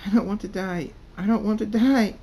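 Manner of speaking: high, loud frantic begging; desperate high-pitched screaming; screamed plea. A narrator's reading. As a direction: scream for your life. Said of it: "Cult Thunderstorm screams".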